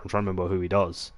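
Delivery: ordinary talk, nothing marked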